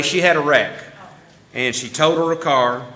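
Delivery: ordinary delivery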